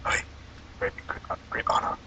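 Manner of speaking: imitating the waiter with a soft unvoiced whisper; bow out with a hushed mock farewell. Host mocks the waiter back while leaving, whispered. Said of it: "did it back to him".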